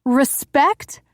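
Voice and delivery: high, loud incredulous echo; wife echoing his word, outraged and rising. Acted. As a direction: shoot up high in disbelief. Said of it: "My voice rose higher".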